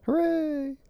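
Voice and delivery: high squeaky hooray; mock cheer. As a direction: cheer it like a kid. Silly cheer in banter.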